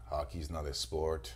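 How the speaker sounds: lightly put-on French accent, low and slow; imitating the French trainer badly; terrible French accent. Trainee mocking his French trainer's accent. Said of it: "maybe a terrible accent".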